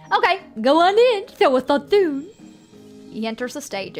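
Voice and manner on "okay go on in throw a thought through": high, loud goofy clown voice. Acted. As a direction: play the doorman clown big and silly. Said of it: "the standard clown voice I've been using".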